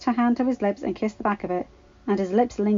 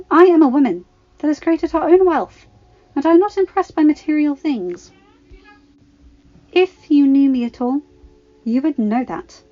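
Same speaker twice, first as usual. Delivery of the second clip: louder, firm and cutting; stern; 4 semitones higher, 6 dB louder than usual. Acted. Padme rebuking her pushy ex-boyfriend, stern and loud. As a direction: put him in his place. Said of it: "Padme replied sternly".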